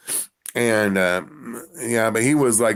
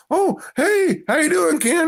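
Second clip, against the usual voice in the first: high bubbly greeting, much higher; fan's wife. Retold or imitated. Fan's wife greeting Ken on FaceTime, high bubbly. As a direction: chirp a delighted hello. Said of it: "she's like".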